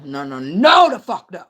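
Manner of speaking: loud, swooping no; emphatic refusal. Acted. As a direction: burst out with a big no.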